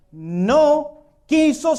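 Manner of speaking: drawn out. Acted. Preacher stresses the refusal.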